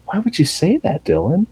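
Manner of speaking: high-pitched imitated hurt question; lightly acted bewilderment; wounded disbelief. Norman questions his brother about Mother, mock-wounded. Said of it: "Norman is so out of it".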